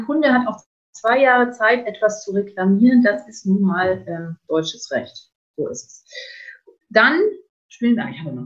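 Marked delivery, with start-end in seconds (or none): 5.6-6.0 whispered aside. Real, not acted. Aside after deutsches Recht.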